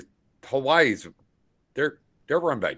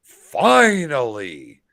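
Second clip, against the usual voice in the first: slow, loud, drawn-out "finally"; triumphant announcement; 6 semitones higher, 10 dB louder, much slower than usual. Retold or imitated. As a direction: announce it like a grand victory. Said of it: "I'm gonna be like".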